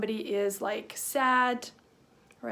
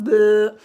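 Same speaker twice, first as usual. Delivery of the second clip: loud tongue-out blurt; goofy, noisy "bleh"; silly "bleh"; 12 dB louder than usual. Shown as a demonstration. Teacher showing kids a sticking-out tongue, silly blurt. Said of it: "So being silly".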